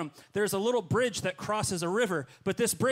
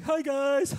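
high, sheepish little hello; high, louder meek hi; sheepish greeting; 10 semitones higher, 4 dB louder than usual. Retold or imitated. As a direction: play it small and awkward, caught out.

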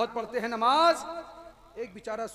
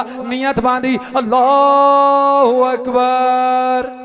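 rhythmic preaching; 4 semitones higher, 19 dB louder than usual. Real, not acted. Preacher tells how the man began prayer, chanting. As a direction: drum out the slogans, then stretch the takbir.

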